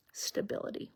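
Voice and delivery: stability breathed out in a soft whisper; whispered key word. Real, not acted. Host naming her recovery keyword, soft whispered stability.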